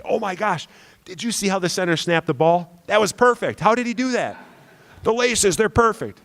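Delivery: high-pitched gushing, timbre pushed off his own; hammed-up amazement, voice way up high; mock amazed fan. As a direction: gush like an over-thrilled football fan. Mock excited fan nudging the neighbour.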